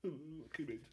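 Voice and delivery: high, small starstruck coo; overawed fan. Retold or imitated. Retelling his hero's hug, mock-bashful. Overawed reply to hugging hero.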